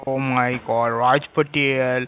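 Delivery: mocking fear. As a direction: pretend to be scared, dragging out each word. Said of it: "Are you mocking me?".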